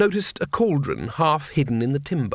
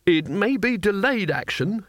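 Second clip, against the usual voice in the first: high, tentative excuse; slow, high-pitched hedging; venturing; 7 semitones higher than usual. A narrator's reading. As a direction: offer it hopefully, drawing it out. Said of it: "he ventured".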